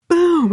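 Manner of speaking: sudden high loud boom noise; imitated boom. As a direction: blurt the bang out loud.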